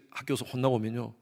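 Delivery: ordinary talk, nothing marked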